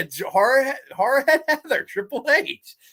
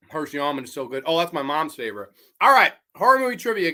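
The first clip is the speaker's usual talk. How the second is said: ordinary talk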